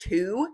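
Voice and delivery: to with a full oo vowel, drawn like two. Shown as a demonstration. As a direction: say to carefully as TOO. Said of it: "I one hundred percent do not say".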